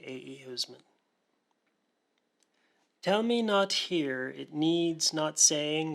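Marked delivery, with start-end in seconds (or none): 3.0-5.9 poem recitation. Acted. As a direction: begin the poem with care, letting each word land. Opening line of recited poem.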